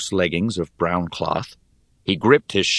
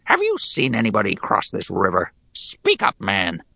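goblin voice; 2 semitones higher than usual. Acted. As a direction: sneer it like a goblin soldier. Goblin questions digger.

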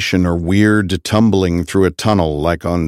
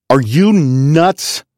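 slow, drawn-out disbelief; stunned, high and slow; exclaiming; 7 semitones higher, much slower than usual. A narrator's reading. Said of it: "I exclaimed".

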